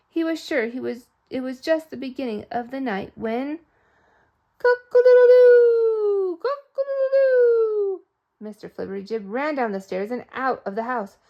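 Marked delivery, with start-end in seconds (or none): 4.6-8.0 high, loud, slow rooster crow. Acted. Storyteller crowing the rooster at the start of the night.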